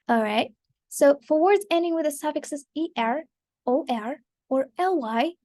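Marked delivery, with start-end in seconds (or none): none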